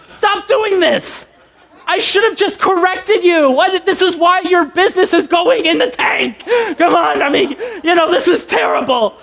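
high-pitched comic rant; exasperated outburst. Acted. Caller fed up with being called ma'am, mock-furious high rant.

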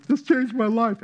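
high, slow, rapturous testimony; hammed-up conversion testimony. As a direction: testify like it saved your soul. Testimony at chocolate conference.

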